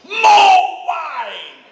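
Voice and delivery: put-on high, very loud drunken roar; bellowed order. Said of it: "He goes".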